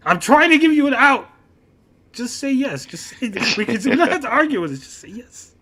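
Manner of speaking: high, loud retold pleading; exasperated plea. Son offering dad a way out, exasperated.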